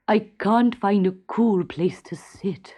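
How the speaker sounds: slow, whispered grumble; a hot, sulky king grumbling. A narrator's reading. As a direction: complain to yourself in the heat. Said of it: "he grumbled".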